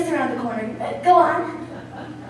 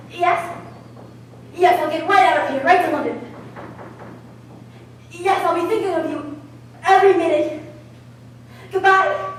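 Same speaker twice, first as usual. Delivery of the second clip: ordinary delivery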